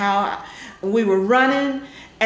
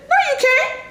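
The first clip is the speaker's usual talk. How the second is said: teasing denial. Retold or imitated. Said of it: "She said".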